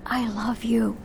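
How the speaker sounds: soft whisper; still small voice. Acted. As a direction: breathe it like a secret. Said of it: "that still small voice".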